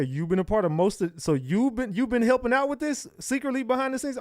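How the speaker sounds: mock disbelief. Real, not acted. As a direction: pretend to be shocked. Mocks the candidate's claim.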